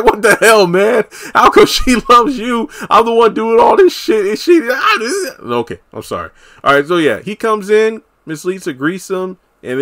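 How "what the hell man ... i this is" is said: loud, high, hammed-up indignant rant; mock outrage. [name] protesting that she loves the other man, mock outraged whine. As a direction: wail in exaggerated jealous protest, half laughing.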